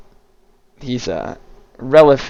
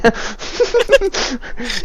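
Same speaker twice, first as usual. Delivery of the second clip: high, softer cackling; villain's cackle; 11 semitones higher, 5 dB quieter than usual. Acted. Anzi gloating over her victim, high cackle. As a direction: keep cackling high, letting it ease off. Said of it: "just standing there cackling".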